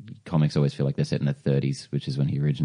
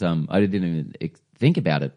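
ordinary delivery

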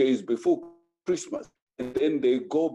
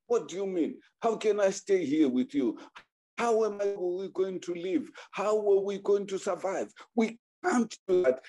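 higher, exasperated husband asking how; mildly raised protest; 6 semitones higher than usual. Retold or imitated. Husband protesting to his wife, exasperated and higher. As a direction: push back at her in disbelief.

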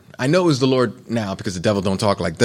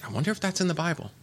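higher, quick curious wondering; wondering aloud; 9 semitones higher, 6 dB quieter, faster than usual. Retold or imitated. Preacher retelling his curious thought, quick and high. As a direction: rush it out, suddenly curious.

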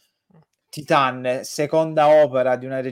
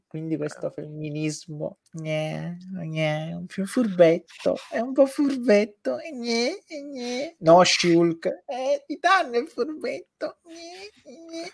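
mocking whiny voice; 9 semitones higher than usual. Mocking critics of Titan.

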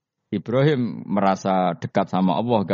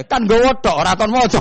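high, loud laughing retort; Umar's cheeky retort; 13 semitones higher, 7 dB louder than usual. Acted. Umar snapping back at the angels, high and loud. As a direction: fire back triumphantly.

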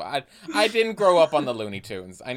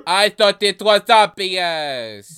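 put-on loud, slow, higher French accent; 4 semitones higher, 9 dB louder, slower than usual. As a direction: drag it out loudly in a French accent. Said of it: "focused on this accent".

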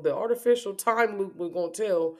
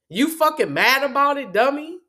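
louder, higher mocking taunt; taunting shout; 6 semitones higher, 6 dB louder than usual. Real, not acted. Ranting at online critics, loud mocking taunt. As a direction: jeer at the doubters, loud and high.

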